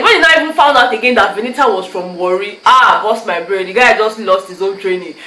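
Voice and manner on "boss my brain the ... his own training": usual speaking voice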